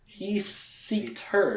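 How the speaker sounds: very slow, drawn-out "seeked her"; mock emphasis. Shown as a demonstration. Joking recap, speaker repeats the wrong word slowly. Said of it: "Sought would be the correct word".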